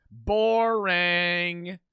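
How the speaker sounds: loud, flat-out bored verdict; mock disappointment. Real, not acted. Mocking the weed reveal, a loud mock-bored verdict.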